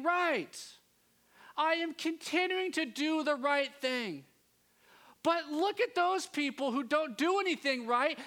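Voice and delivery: exasperated good son venting; aggrieved rant. Retold or imitated. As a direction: rail against the unfairness. Older brother's rant, rising.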